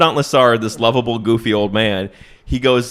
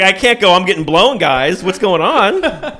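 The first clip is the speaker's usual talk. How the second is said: put-on higher and louder, laughing through the flustered old man's words; laughing mock panic; 9 semitones higher, 6 dB louder than usual. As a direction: crack up while playing the panicked commandant. Flustered old man, teller laughing.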